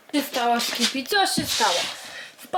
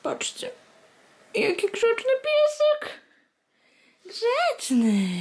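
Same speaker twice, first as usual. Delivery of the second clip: very high praising coo; 10 semitones higher, slower than usual. Real, not acted. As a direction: gush over the good dog. Praising the dog. (bardzo wysokie zachwycone gruchanie)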